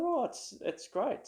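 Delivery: high, gushing excitement; light up over the find; excited delight. Retold or imitated. Finding the ukulele online, excited.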